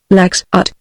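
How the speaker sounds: usual speaking voice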